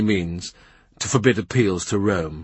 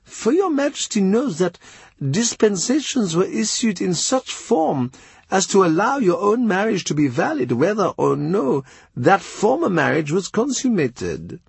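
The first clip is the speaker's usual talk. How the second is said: high, careful foreign envoy voice; Chapuis voice; 10 semitones higher than usual. A narrator's reading. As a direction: Chapuis speaking with courtly hesitation. Ambassador voice again.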